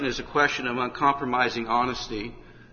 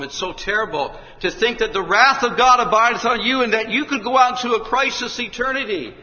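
building to a high, loud shouted plea; preacher pleading that tonight may be the last; climactic preaching; 12 semitones higher, 10 dB louder than usual. Acted. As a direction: rise to a fever-pitch warning.

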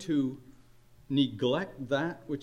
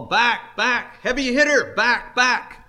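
high, loud shout of Back, back to the fielders; imitating a baseball outfield call, shouted high and loud; 8 semitones higher, 9 dB louder than usual. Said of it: "you'll often hear the call".

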